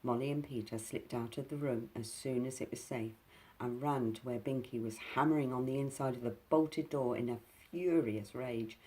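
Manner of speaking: ordinary delivery, nothing marked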